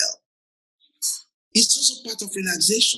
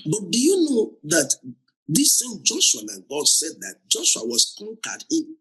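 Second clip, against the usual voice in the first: usual speaking voice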